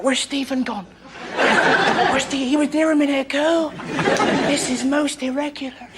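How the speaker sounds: soft, high mock-child voice; small, hushed, high voice of baffled kids; puzzled little boys. Mates looking for hidden Stephen, soft high puzzlement.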